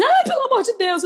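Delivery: put-on high, panicked child's plea; mock scared kid. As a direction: squeal it like a frightened boy. Criança assustada imitada. (criança assustada, voz aguda)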